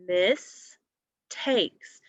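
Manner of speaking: "miss" then "takes" as two separate beats; syllables split apart. Shown as a demonstration. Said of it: "second part of the word takes".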